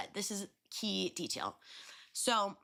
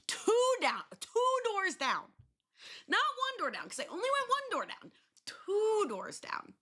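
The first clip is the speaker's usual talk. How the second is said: incredulous emphasis; 4 dB louder than usual. Real, not acted.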